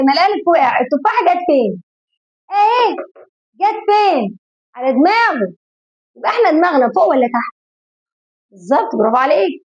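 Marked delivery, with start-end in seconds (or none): 2.5-4.4 high teacher question. Shown as a demonstration. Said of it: "أول ما الإيه".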